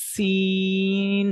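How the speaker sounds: সিন stretched very long; very slow সিন, the s held long; drawn-out sin. Shown as a demonstration. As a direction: drag out সিন slowly, hissing the s. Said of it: "টেনে".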